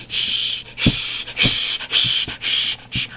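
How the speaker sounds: whispered mock shushing, over and over. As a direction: mimic someone shushing you. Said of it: "You know how annoying that is".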